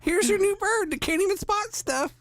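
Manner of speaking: game voiced as a high mocking character; mocking Valhalla voice. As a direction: voice the game as a smug troll. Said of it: "voicing the game mockingly".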